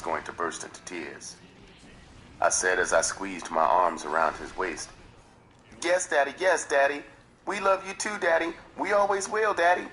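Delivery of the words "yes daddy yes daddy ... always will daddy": put-on high child voice, earnest and pleading; high boy's voice promising his stepfather love; young boy's voice. A narrator's reading. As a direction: voice the ten-year-old clinging to his father. Boy's voice in retold scene.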